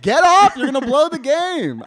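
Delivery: high, very loud yell at the TV; frantic fan's full shout; going nuts. Retold or imitated. Fan going nuts on the couch at the game, yelling. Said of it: "I was going nuts on my couch".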